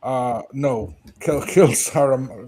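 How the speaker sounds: normal talking voice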